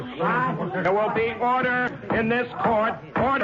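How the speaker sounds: high, slow, commanding; stern call to order. Acted. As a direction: call the court to order firmly. Judge gavelling down courtroom uproar.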